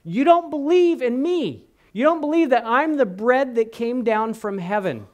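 You don't believe, slowed and pushed up high; confronting Jesus. Acted. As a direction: call out their unbelief, pressing. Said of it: "this conversation takes a shift right here".

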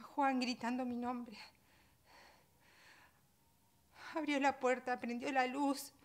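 distraught, building to a loud high cry; rising anguish. Acted. Mother reliving Juan finding her with the baby, rising anguish.

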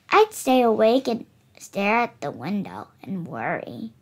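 usual speaking voice

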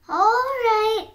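high, loud, drawn-out agreement; storybook sigh. A narrator's reading. Piglet giving in before the oven, sighing. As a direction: stretch it out in surrender. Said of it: "sighed the fox".

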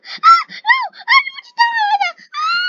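very high, loud terrified screaming. Real, not acted. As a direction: scream for your life. About to be eliminated, screaming.

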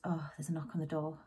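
fast hushed aside; whispering. Real, not acted. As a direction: whisper it quickly. Interrupted by a knock.

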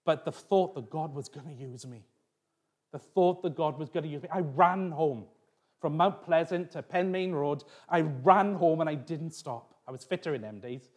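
ordinary delivery

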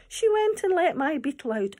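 normal talking voice

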